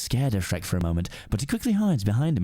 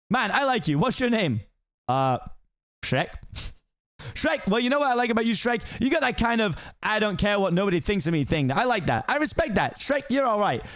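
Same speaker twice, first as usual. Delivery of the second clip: high fast-talking donkey voice; excited Donkey; 10 semitones higher than usual. Acted. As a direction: gush admiringly at the ogre. Donkey befriends Shrek.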